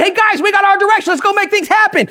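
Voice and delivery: high-pitched, excited imitation; all excited. Peter to the others once they have direction, all excited. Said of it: "he's all excited".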